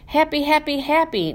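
high, bouncing happy chant; delighted. A narrator's reading. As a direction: burst with joy on each happy. Hamster delighted by postcard.

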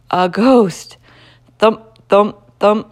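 loud, punched ghostly thumps; ghost-story suspense. A narrator's reading. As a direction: land each word like a heavy footstep.

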